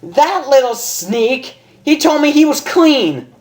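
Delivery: high, furious shriek; angry outburst. Acted. Rainbow raging at her boyfriend, high furious. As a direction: spit it through clenched teeth. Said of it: "she grit her teeth".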